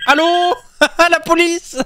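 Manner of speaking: high laughing mock phone call. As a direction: giggle through a fake call for help. Mock calling police while laughing. (appel à la police pour rire, en riant, voix haute)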